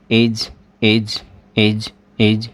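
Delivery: एज drawn out slowly; teacher repeating एज slowly; slow pronunciation demo. Shown as a demonstration. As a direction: sound out एज for the class. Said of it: "एज, यहाँ तक क्या हो गया?".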